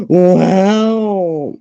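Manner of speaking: loud, high, long drawn-out exclamation; amazed. Real, not acted. Reacting to the project working out, amazed. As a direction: stretch it out in big wonder.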